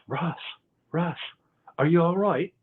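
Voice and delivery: a light, mocked-up accent of a worried friend; lightly imitated accent, calling to wake a friend; Adam's accent. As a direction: call his name with a hint of Adam's accent. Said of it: "how do you like that accent".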